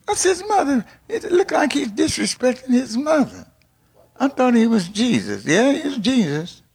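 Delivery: high-pitched mock disbelief; scandalized onlookers. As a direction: gasp it out like shocked gossips, high. Voices onlookers shocked at Jesus's words.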